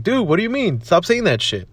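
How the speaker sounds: high-pitched mock pep talk; lightly imitating a blunt mentor; Gary Vee. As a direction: toss it off as a brash pushy coach. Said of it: "And then Gary Vee's like".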